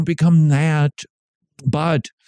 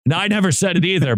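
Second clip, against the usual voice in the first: ordinary talk, nothing marked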